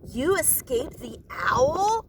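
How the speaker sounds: slow, breathy whispered disbelief; cried out. A narrator's reading. Stellaluna crying out in wonder to her mother. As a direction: gasp it like a miracle. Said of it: "cried Stellaluna".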